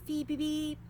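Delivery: high chickadee whistle; whistled birdcall. A narrator's reading. Narrator imitating a chickadee's whistle. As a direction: chirp it like a bird.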